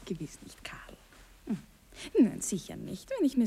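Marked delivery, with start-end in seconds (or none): 0.0-1.0 soft whispered denial. Acted. Wife quietly denying to husband.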